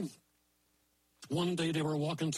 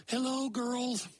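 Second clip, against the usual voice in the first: higher, slow sing-song greeting; sweet mummy; 7 semitones higher, slower than usual. Acted. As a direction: greet the girls lovingly. Mother greets daughters sweetly.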